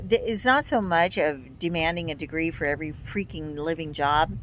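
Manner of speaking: ordinary talk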